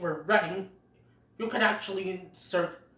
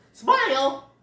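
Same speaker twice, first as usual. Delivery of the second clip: possum voice; 7 semitones higher, 6 dB louder than usual. Retold or imitated. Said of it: "another possum goes".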